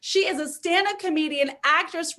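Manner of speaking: ordinary delivery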